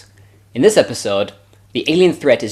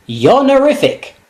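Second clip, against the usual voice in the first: 1 mock staff catchphrase; 8 semitones higher than usual. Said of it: "that interjection so frequently used".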